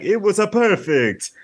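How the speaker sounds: high, drawn-out mock Italian accent; thick Italian accent. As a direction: imitate a thick cartoon Italian accent, slowly. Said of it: "Italian accent on too thick".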